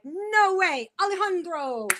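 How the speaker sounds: high, drawn-out announcer call; announcement. Retold or imitated. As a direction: announce the name with a mock flourish. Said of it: "It just needs an announcement".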